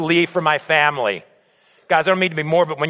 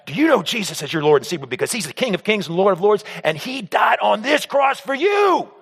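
mock evangelizing; 4 semitones higher than usual. Pastor witnessing at work, mock preaching crescendo. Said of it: "a little embarrassed to tell this story".

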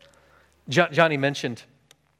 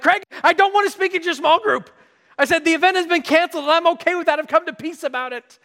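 very high, frantic protest; worked-up pleading to be let off; exasperated refusal; 3 dB louder than usual. Retold or imitated. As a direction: whine your objection at full pitch. Acted refusal to his friend.

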